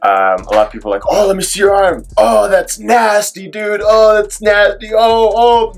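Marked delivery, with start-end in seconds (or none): 1.1-5.7 imitating friends' high, disgusted gasps at the arm; mock the friends going oh, that's nasty, high and drawn out; grossed-out gawking. Friends gawk at his dangling arm, high mock disgust.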